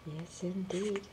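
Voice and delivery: ordinary delivery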